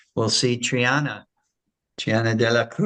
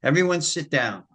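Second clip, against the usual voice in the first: raised-pitch teacher's command; imitated commanding call, firmly delivered; authoritative; 6 semitones higher, faster than usual. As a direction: order a roomful of kids into place. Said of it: "very authoritative male voice".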